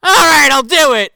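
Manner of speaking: high, loud, hammed-up damsel cry; mock dramatic surrender. Bo Peep gives in to the villain, mock dramatic.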